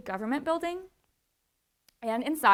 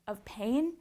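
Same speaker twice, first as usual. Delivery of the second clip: normal talking voice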